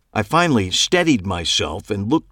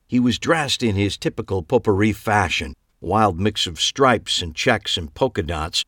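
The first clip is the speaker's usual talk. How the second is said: ordinary delivery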